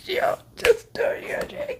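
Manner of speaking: high breathless whisper; whispered cheer. Real, not acted. Urging Yuji on, high breathless whisper. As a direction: egg him on under your breath.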